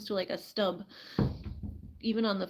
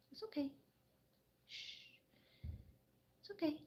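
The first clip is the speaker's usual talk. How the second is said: much softer, higher it's okay; soft higher shushing to calm a pet; hushed soothing; 6 semitones higher, 16 dB quieter than usual. Real, not acted. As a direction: hush the frightened hen. Hushing the hen in water.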